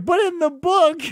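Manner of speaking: high, loud pedantic objection; high-pitched sputtering complaint; mock protest. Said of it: "And I'm like".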